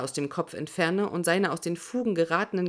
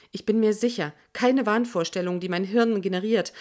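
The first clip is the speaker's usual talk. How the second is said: usual speaking voice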